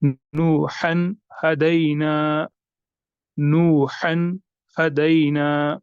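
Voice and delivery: "नूहन हदैना" very slow, न of नूहन sounded clearly; drawn-out careful recitation; clear tanween. Shown as a demonstration. Teacher recites example for students. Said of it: "इजहार होगा".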